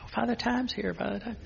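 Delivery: high, sing-song mocking greeting; mock the grandmother's call; teasing cousin. Cousin teasing the priest, high sing-song mimicry. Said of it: "I'd say".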